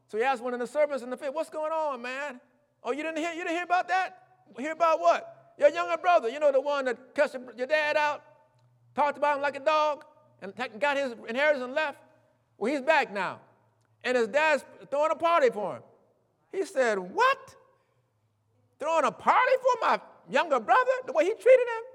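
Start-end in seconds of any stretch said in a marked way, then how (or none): 1.3-15.8 higher, lively servant voice telling the news; servant gossiping. Retold or imitated. Servant telling older brother news, higher excited voice. 17.0-21.8 high, louder indignant disbelief; outraged brother. Retold or imitated. Older brother hearing of party, outraged high disbelief.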